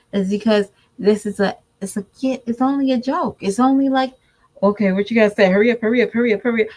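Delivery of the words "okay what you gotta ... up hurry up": impatient, run-together hurry-up; rushing someone. Retold or imitated. Mother rushing her shy daughter to talk, hurried and impatient. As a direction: repeat it insistently, hurrying the speaker along. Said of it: "because you rush her".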